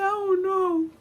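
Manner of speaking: high tearful whine; mock crying. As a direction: act out a cornered daughter starting to cry. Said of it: "I'm crying too".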